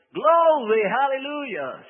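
high, loud glory shout, hallelujah drawn out slowly; church shout. Retold or imitated. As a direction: cry out praise in church. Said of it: "or they're yelling".

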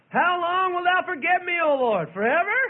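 loud, high anguished plea to God; crying out. Acted. David demanding answers from God, crying out. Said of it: "Cried out, didn't he?".

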